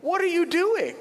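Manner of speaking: high, loud disbelieving cry; twelve-year-old stunned as friend shelves his gift; shocked. Retold or imitated. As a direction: blurt it out in total disbelief. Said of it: "And I went".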